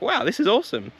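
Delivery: reliving the thrill of the Vespa idea; excited. Retold or imitated. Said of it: "I just got really excited".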